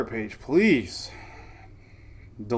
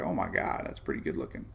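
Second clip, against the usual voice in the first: awed hush; 7 semitones lower, 9 dB quieter than usual. Retold or imitated. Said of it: "you'd be like".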